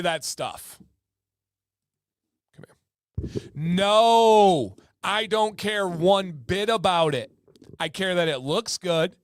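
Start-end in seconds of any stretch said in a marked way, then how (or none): 3.5-7.3 loud, drawn-out no, then firm; emphatic no. Real, not acted. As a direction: belt out the no and insist. Emphatic answer to own question.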